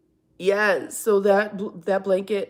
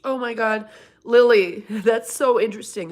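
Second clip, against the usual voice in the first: excited laugh; 10 dB louder than usual. Real, not acted. Reacting to chat message excitedly.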